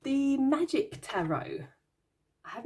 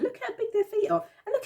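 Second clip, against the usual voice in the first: laughing; 13 semitones higher than usual. Real, not acted. Laughing at huge feet on card.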